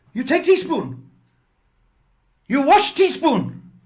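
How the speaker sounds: high, loud, broken-English orders; mock Chinese accent. Landlady laying down rules to lodger, high loud mock accent. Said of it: "that's how she talked".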